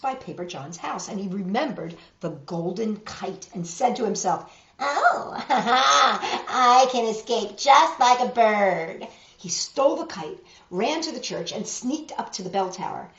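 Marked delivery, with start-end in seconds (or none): 4.8-9.1 loud laughing devil voice, slow; gloating laugh. A narrator's reading. Devil gloating to himself about stealing the kite. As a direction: cackle with glee at your plan.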